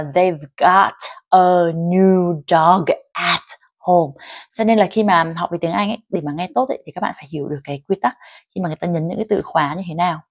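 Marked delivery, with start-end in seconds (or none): 0.0-4.1 slow, every word fully sounded; over-careful, drawn-out reading; unnatural word-by-word. Shown as a demonstration. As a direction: separate each word, no linking at all. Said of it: "người ta sẽ không đọc cho mình là".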